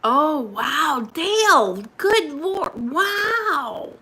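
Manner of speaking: high, drawn-out astonishment; real, high-pitched amazement; amazed. Real, not acted. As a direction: react with big, swooping wows. Amazed reaction to a viewer.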